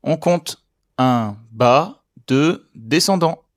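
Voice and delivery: counting each mark slowly; slow, careful teacher count. Shown as a demonstration. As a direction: spell the marks out slowly. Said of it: "On voit le signe numéro trois".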